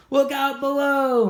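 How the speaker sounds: high, loud, slow yell down the hill; thrilled kid yelling look out; excited scream. Acted. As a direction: shout it as you hurtle down. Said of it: "Molly Lou Mellon screamed".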